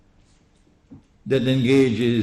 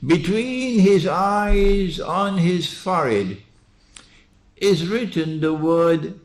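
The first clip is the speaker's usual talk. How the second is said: high, slow, emphatic pulpit voice; fervent preaching; 5 semitones higher than usual. Acted. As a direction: proclaim it from the pulpit. Preaching at full force.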